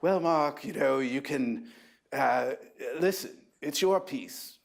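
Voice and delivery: lightly imitating a mid-Atlantic editor; mid-Atlantic accent. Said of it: "he had this wonderful mid-Atlantic accent".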